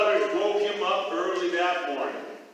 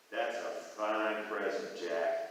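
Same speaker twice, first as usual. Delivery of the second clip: much lower, slow royal voice; deep king voice; 13 semitones lower, 9 dB quieter, slower than usual. Acted. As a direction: play the king low and slow.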